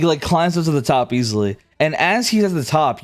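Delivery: usual speaking voice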